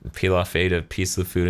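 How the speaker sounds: normal talking voice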